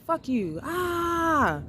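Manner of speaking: retold screaming. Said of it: "So I was screaming".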